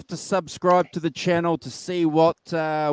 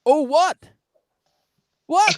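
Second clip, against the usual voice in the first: high, loud startled what; 10 semitones higher, 5 dB louder than usual. Real, not acted. Cohost hears the host's strange voice, startled. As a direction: react in shock to the weird voice.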